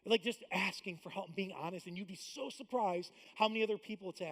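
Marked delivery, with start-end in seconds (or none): none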